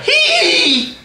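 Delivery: very high "hee" whoop; mock squeal. Storyteller acting out his jump for a layup, mock squeal. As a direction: squeal it out in falsetto. Imitated cry going up for layup.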